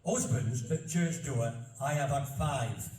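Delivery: slow, deliberate broad Yorkshire recital; careful second read in Barnsley dialect; measured old verse in thick Yorkshire; reading in Yorkshire dialect again. Shown as a demonstration. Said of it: "I'll say it again".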